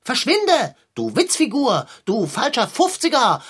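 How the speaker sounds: cat yelling at the stranger in the doorway; angry shout. Acted. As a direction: drive the clown away, yelling insults. Cat chases off a clown.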